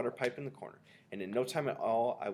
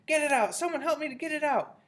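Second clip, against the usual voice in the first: high, frantic squawk, growing louder; screaming; 4 dB louder than usual. A narrator's reading. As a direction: plead in rising panic. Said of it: "the man was screaming".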